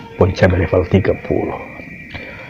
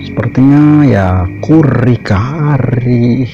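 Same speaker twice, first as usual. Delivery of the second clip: slow drawn-out; 4 semitones higher, 4 dB louder, much slower than usual. Acted. Playful food vlog naming.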